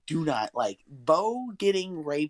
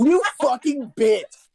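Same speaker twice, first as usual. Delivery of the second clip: slow, high, loud laughing jab; laughing insult; 10 semitones higher, 5 dB louder, slower than usual. Real, not acted. Cursing Robert for not helping, slow loud laugh.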